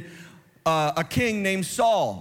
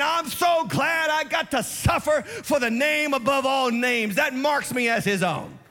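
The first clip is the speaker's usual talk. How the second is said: Peter's glad cry, pitched higher; joyful Peter; 7 semitones higher than usual. Retold or imitated. Peter leaving prison, glad to suffer, proclaiming high. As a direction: ring out Peter's joy at suffering. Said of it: "He comes out saying".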